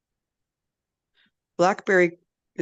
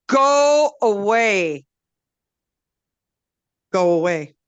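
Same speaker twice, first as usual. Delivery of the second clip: very slow, loud, higher growl; grumpy tree; 3 semitones higher, 7 dB louder, slower than usual. A narrator's reading. Blackberry rejecting the medicine, slow loud growl. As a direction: stretch out the refusal heavily. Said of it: "And Blackberry said".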